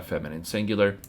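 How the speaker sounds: ordinary talk, nothing marked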